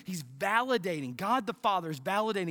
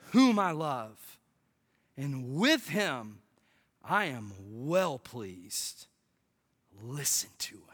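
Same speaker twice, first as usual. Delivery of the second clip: solemn voice of God; 8 semitones lower, slower than usual. Acted. Reading God's voice in scripture.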